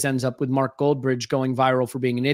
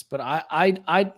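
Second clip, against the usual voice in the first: usual speaking voice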